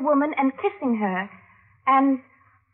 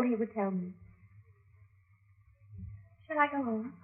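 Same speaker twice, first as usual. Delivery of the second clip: much softer, coy hush; confiding a secret; 4 semitones lower, 13 dB quieter than usual. Acted. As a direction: trail off softly, coyly. Laura's coy testimony.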